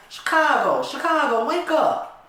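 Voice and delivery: high, loud urgent call. Acted. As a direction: shake him awake with your voice. Calling sleeping friend awake.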